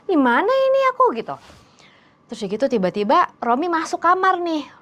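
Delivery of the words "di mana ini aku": high panicked question; shocked. Retold or imitated. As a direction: ask in sudden fear, much higher. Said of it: "dia kan kayak kaget gitu". (bertanya panik dengan suara tinggi)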